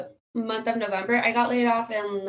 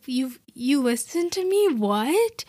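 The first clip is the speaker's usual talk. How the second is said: retold shock; 3 semitones higher, slower than usual. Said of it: "I was actually shocked".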